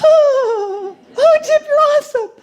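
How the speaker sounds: high, loud mock adoration; gushing audience. Mocking the audience adoring Chip, high gushing. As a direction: coo like a dazzled believer. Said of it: "they're like".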